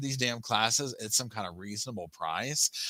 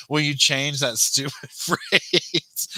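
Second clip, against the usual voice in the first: laughing through it; 4 semitones higher, 5 dB louder, much slower than usual. Retold or imitated. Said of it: "the super chat will be".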